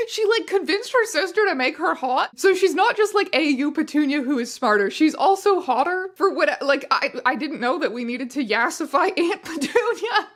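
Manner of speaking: high, loud laughing disbelief; can't believe what you're reading; loud, amused disbelief; incredulous. Real, not acted.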